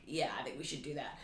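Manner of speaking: quiet. Retold or imitated. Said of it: "And he was like".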